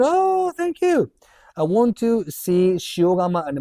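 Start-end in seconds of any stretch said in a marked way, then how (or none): 0.0-1.1 delighted thanks. Real, not acted. Thanking viewer warmly.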